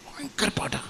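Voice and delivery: a breathy aside, just to the room; whispered aside. Real, not acted. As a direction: whisper the remark, no voice. Aside about hard poem. (മന്ത്രിക്കുന്ന സ്വരത്തിൽ ഒരു പരാമർശം)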